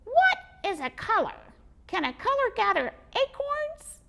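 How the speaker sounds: high squeaky puzzled squirrel. Acted. Squirrel baffled by the boy, squeaky.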